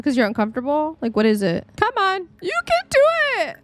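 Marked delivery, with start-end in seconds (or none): 1.8-3.6 squeaky high encouragement; high-pitched come-on-you-can-do-it cheer. Real, not acted. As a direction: urge the little sim on, pitch soaring. Cheering on a stuck sim.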